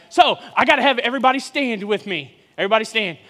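ordinary talk, nothing marked

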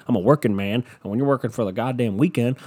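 ordinary talk, nothing marked

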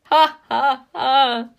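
loud, high jeering ha ha ha; mocking laughter. A narrator's reading. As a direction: cackle at her like spiteful stepsisters. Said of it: "stepsisters laughed so hard".

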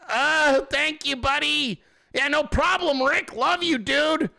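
high, over-friendly awkward thanks; put-on chummy cheer, pitched high; embarrassed politeness. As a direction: imitate someone too embarrassed to correct a name. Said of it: "correct him 'cause you're embarrassed".

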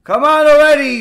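loud, high mock exasperation; fed-up outburst. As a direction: burst out like a husband at his limit. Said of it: "he's had it".

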